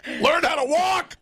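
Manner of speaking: high barking drill sergeant yell. Acted. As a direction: bark orders like a drill sergeant. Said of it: "I sound like a drill sergeant".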